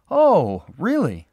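high, loud startled reaction; startled, exclaimed question; taken aback. A narrator's reading. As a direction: react with sudden surprise, pitch leaping up. Norman uneasy on meeting a detective.

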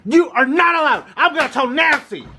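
high, indignant scolding; outraged protest. Pretend. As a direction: protest in a high voice, fending someone off. Quarrel over being touched.

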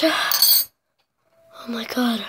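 very slow whispered disgust; whispering. Real, not acted. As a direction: whisper it slowly, drawn out.

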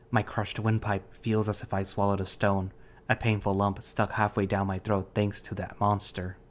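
ordinary delivery

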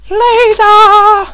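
long, very high sung-out laser call; falsetto battle cry. Pretend. Gamer hamming up his laser weapon in a falsetto cry. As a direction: wail the weapon name like a hero.

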